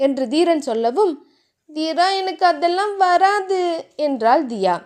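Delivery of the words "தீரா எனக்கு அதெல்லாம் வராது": put-on higher, bashful girlish voice; flustered young wife; shy heroine. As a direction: brush him off shyly, voice lifted.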